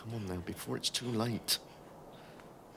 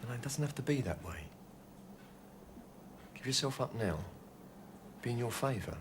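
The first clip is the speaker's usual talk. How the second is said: gentle coaxing; 8 dB quieter than usual. Acted. Coaxing gunman to surrender.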